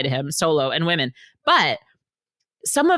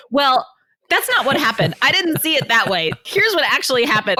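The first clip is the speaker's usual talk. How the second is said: higher, indignant husband voice reading his texts; husband correcting; 10 semitones higher, 4 dB louder than usual. Retold or imitated. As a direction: correct her like a hurt husband. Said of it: "I keep getting text messages".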